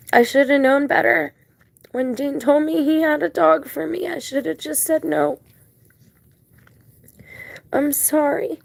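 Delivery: high, upset voice choked with guilt; tearful regret. Real, not acted. Woman blames herself to her dog, tearful regret. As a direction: apologise through rising tears, pitch climbing.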